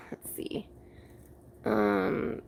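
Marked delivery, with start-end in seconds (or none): 0.1-0.7 whispering. Real, not acted. Whispering to self while setting up.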